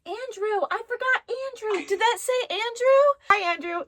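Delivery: high loud thrilled squeal; excited. Real, not acted. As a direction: burst out with delight. Excited at hearing a name.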